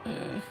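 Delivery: deep, soft disgusted ugh; a heavy groan under the breath; low fed-up groan. Retold or imitated. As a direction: let out a tired, low ugh. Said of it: "you're like".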